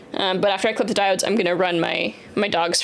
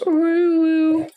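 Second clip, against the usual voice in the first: high, louder mock whimper of a dog; dog whine imitation; 8 semitones higher, 5 dB louder than usual. Imitating her dog's grumbly whine for chat, mock whimper. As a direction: whine it like a sulky pup. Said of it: "could you just hear his whine".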